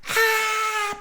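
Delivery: short high shriek; mock scream. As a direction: fake a horror scream. Mock scream after scary music.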